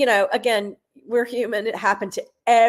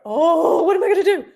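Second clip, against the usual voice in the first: mock panic; 8 semitones higher, 6 dB louder than usual. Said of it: "you could see her eyes like".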